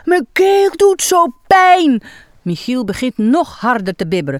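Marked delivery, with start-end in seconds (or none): 0.0-2.0 slow, higher, louder whimpering complaint; whiny sick clown. Acted. Clown Michiel moans to clown Iris, slow whiny sick voice. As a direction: play the poor patient, dragging it out.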